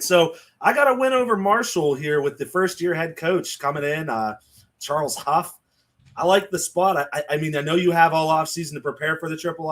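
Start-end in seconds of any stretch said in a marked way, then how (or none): none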